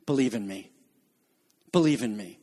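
low, soft "Believe in me"; gentle plea. Acted. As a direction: invite them tenderly. Jesus quoted, gentle.

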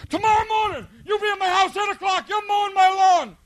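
very high, shouted threat; hammed-up drill sergeant shout; screaming drill instructor. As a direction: get right in his face and yell. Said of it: "So he goes".

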